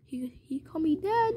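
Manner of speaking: hushed storytelling whisper; really quiet. Acted. Man privately moved at being called Dad, whispering. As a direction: whisper it to yourself, voiceless. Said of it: "really quietly".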